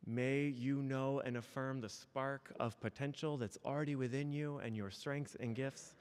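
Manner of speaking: spoken blessing. Acted. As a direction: lay the blessing on them gently and unhurried. Minister speaks closing blessing.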